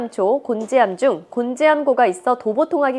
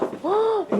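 sharp high gasp of amazement; impressed gasp; 9 semitones higher, 6 dB quieter than usual. Acted. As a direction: gasp as if wowed by the room. Gasps entering pantry.

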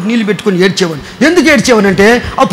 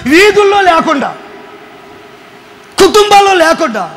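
fiery preaching; 7 semitones higher than usual. Acted. Pastor preaching hard to his church, in full cry. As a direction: preach it out to the congregation.

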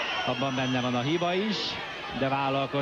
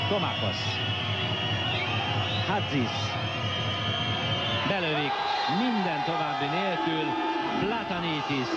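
rising, urgent play-by-play call; excited Hungarian sports commentary; 5 semitones higher than usual. Acted. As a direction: call the attack as it unfolds. Opponents scoring, live call. (izgatott sportközvetítés)

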